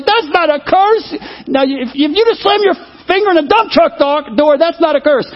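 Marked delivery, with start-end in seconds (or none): 0.0-1.1 indignant denial. Retold or imitated. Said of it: "I was like".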